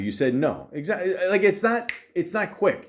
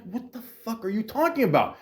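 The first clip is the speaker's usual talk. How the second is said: higher, incredulous, pushed out; exasperated at the manager's non-answer; baffled exasperation; 8 semitones higher than usual. Retold or imitated. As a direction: disbelief at the nonsense. Retold baffled outburst at manager.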